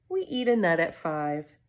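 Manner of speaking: ordinary delivery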